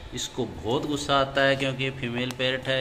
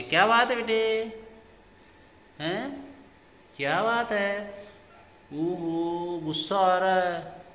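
pet talk; 6 semitones higher than usual. Real, not acted. Owner soothing the angry female parrot in baby-talk.

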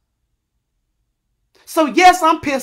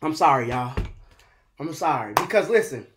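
much softer and lower, voice nearly breaking; choked-up apology; 12 semitones lower, 10 dB quieter, faster than usual. Real, not acted. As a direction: pull it back, close to tears. Breaks off after long pause.

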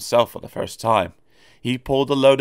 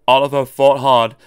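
ordinary delivery, nothing marked

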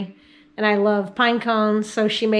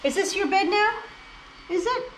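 much higher, sing-song coo at the dog; 10 semitones higher, 4 dB quieter than usual. Real, not acted. Owner cooing at her dog on the bed, high doting baby talk.

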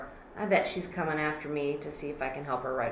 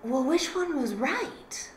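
puzzled; 7 semitones higher, slower than usual. Retold or imitated. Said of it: "you're like".

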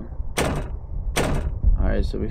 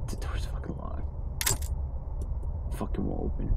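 whispered grumble; 5 dB quieter than usual. Real, not acted. Annoyed aside.

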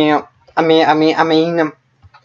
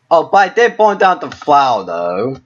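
normal talking voice

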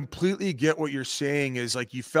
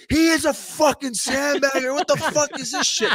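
much higher, imitating an outraged yell; mock outrage; 13 semitones higher, 3 dB louder than usual. Imitating McKinnon yelling from the cart, high mock outrage. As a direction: squeal it like a sore loser.

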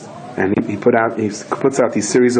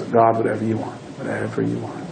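hushed, imitated prayer of giving in; humble surrender; 6 dB quieter than usual. Husband puts his head down and speaks to God, hushed surrender. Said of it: "he puts his head down and goes".

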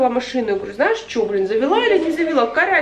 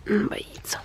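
whispering; 8 dB quieter than usual. Real, not acted. Whisper near cats.

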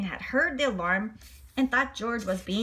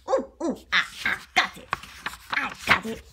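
monkey chatter. Acted. George agreeing with the director, monkey chatter.

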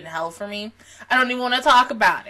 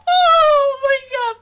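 high loud shriek of shock; 10 dB louder, slower than usual. Real, not acted. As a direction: squeal it out in disbelief. Shocked reaction to anime scene.

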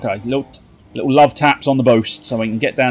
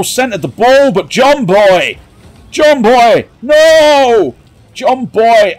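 high, loud anguished cheering; excited yell; 8 semitones higher, 6 dB louder, slower than usual. Real, not acted. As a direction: yell at the teammate in despair.

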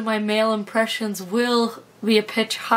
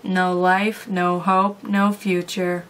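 low flat monotone; lifeless, bored delivery; Daria deadpan; 4 semitones lower than usual. Retold or imitated. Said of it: "Oh, Daria".

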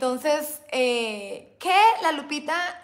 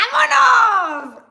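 high, loud, slow hype yell; catchphrase shout; 9 semitones higher, 11 dB louder, much slower than usual. Acted. Vlogger hyping up her live chat, shouting. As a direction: belt out the catchphrase.

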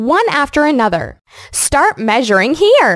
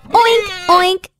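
higher, bouncy oinking like a pig; 7 semitones higher than usual. Retold or imitated. Teaching kids what a pig says, bouncy oinks. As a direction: oink up high like a cheerful piglet. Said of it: "My pig says".

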